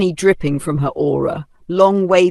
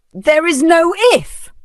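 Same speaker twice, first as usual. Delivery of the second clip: high, loud cutting shout; furious shout; 13 semitones higher, 6 dB louder than usual. A narrator's reading. Vanessa interrupting the old woman's apology with a shout. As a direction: cut her off with a yell. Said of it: "Vanessa shouted".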